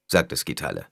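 usual speaking voice

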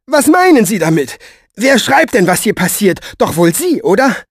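high, loud, accusing questions; bossy woman demanding answers. Acted. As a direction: pin the blame with each question.

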